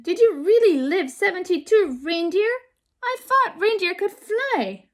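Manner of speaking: put-on high, eager child asking; a young girl full of wonder; little girl voice. A narrator's reading. Lily asks Thorsten about reindeer in a high child voice.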